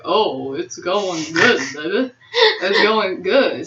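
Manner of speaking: low, slow put-on deep voice. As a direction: drop the voice low and drawl it slowly. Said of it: "I'm like".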